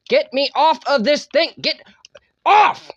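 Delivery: frustrated shout rising to a near scream; irritated yelling. Real, not acted. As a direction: yell at the game to let go. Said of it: "I got a little irritated".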